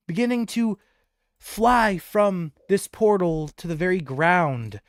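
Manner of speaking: lively, building game master narration; excited fantasy narration; scene setting. A narrator's reading. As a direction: paint the carnival for the players.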